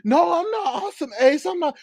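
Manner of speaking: high, put-on voice of the denying woman; mock denial. Mocking a viewer's denial, high put-on voice. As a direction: squeak out a fake innocent denial.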